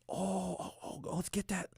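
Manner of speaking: high, soft cooing oohs; put-on high fussing voice; fussing consultant. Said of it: "she's like".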